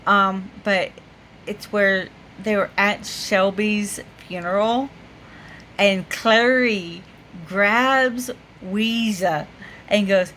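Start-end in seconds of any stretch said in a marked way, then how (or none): none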